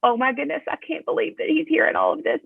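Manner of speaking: high-pitched inner voice of dismay; high, mildly aghast aside; retold dismayed thought. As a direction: voice a shocked thought, pitched well up. Voicing her dismayed thought.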